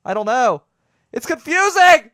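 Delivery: loud high cry of bafflement; exasperated outburst. Real, not acted. Baffled host venting about their puzzling accent, exasperated outburst. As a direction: build from frustration to a high shout.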